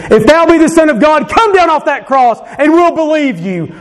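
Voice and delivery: high jeering taunt; a high mocking call from below the cross; mocking crowd.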